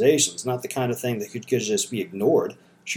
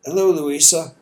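put-on light Southern accent, a touch higher and louder; 5 semitones higher, 5 dB louder than usual. A narrator's reading. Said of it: "in her warm, never polite Southern accent".

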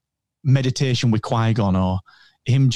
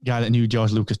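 ordinary talk

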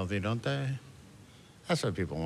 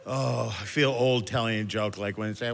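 ordinary talk

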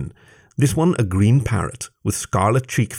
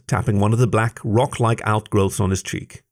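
ordinary delivery

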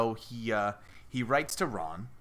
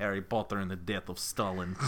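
low, hammed-up Russian accent; 3 semitones lower, 3 dB quieter than usual. Shown as a demonstration. Podcaster pitching a Stalin sequel title, Russian accent. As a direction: announce it in a bad Russian accent. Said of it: "good accent I have is a Russian one".

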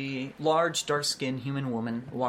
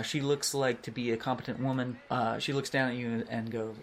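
usual speaking voice

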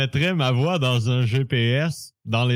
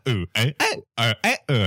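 robotic stutter; 3 semitones lower than usual. Shown as a demonstration. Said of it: "vraiment robotique".

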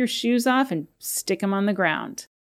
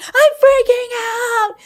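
much higher, louder, slow mock scream of panic; exaggerated panic shriek; 7 dB louder, slower than usual. Shown as a demonstration. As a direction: let the panic burst out in a big hammed-up yell. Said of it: "let yourself go".

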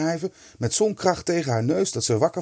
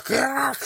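high, drawn-out cracking noise word; imitated crack; 13 semitones higher than usual. A narrator's reading. Storyteller voicing the chair leg breaking, imitated crack. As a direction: sound out wood snapping, high-pitched. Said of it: "zei een poot".

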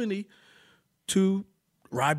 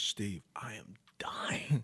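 slow, softer hushed whisper; whispered aside; 7 semitones lower, 13 dB quieter, slower than usual. Retold or imitated. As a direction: whisper it so the rider ahead can't hear. Neighbor secretly complains mid-ride.

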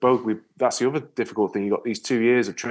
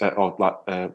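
ordinary talk

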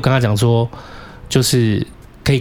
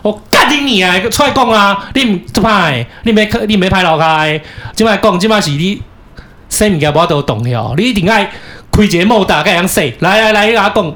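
cursing yell; 5 semitones higher than usual. Retold or imitated. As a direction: yell it at the closed door. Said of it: "我就直接骂三字经".

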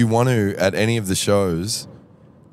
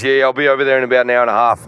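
quick, higher tradie voice; tossed off casually, raised voice; Michael's voice; 5 semitones higher, 6 dB louder, faster than usual. Retold or imitated. Impersonating Michael.

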